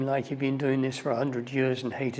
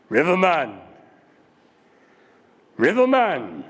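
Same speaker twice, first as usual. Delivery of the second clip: loud, far-carrying call; 3 semitones higher, 8 dB louder than usual. Retold or imitated. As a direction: call out from the back of the boat. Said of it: "this voice calls out".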